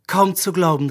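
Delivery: plain reading voice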